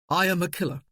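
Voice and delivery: a lightly clipped, abrupt confession; said abruptly. A narrator's reading. Soldier confessing to his wife, said abruptly. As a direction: blurt it out flatly, a touch abrupt. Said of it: "he said abruptly".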